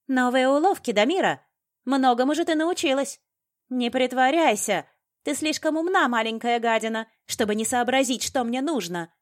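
high, loud angry accusation; shrieked, scornful rage; furious tirade. A narrator's reading. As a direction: berate the captive at the top of your voice. Enraged villainess berates her captive.

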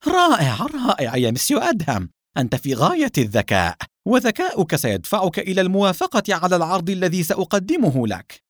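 flustered. A narrator's reading. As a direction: praise him anxiously to win him over. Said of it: "وقال باضطراب".

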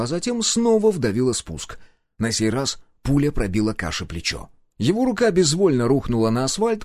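plain reading voice

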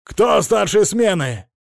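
slow, loud, high shout. A narrator's reading. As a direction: yell at the guards. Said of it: "Хозяин так кричал".

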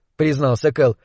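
ordinary delivery, nothing marked

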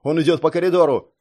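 urgent call through a door; shouting. A narrator's reading. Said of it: "крикнул Китай-Городцев".

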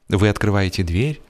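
ordinary delivery

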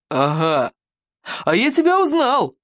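aha, then a high gleeful burst; triumphant exclamation. A narrator's reading. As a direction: pitch leaping high on the discovery. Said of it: "воскликнул он".

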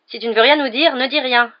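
irritated, pushing the words out; grumbling. A narrator's reading. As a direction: grumble it crossly. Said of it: "grogna Ron".

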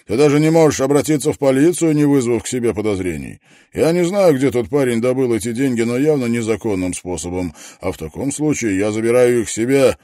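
loud, hard, pressing; domineering man. A narrator's reading. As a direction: dictate terms without mercy. Harvey dictates terms. (властно)

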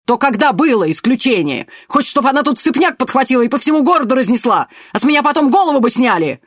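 high, loud angry tirade; furious yelling. A narrator's reading. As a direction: scold him at full volume. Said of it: "рявкнул Пак".

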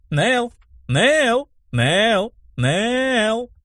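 high, rising name calls; teasing calls. A narrator's reading. Vilma running off taunting her friend, high drawn calls. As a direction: sing out the name high, last one longest.

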